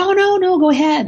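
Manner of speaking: high, eager and loud; a surprised stranger happily waving her ahead; eager reply. Retold or imitated. Stranger in the customs line lets her cut ahead, eager and high.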